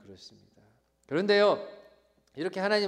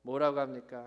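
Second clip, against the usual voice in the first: ordinary talk, nothing marked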